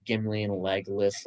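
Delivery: ordinary talk, nothing marked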